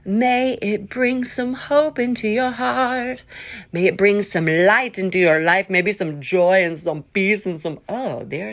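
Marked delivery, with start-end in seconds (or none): 0.0-3.2 slow, lilting announcer voice; show intro. Acted.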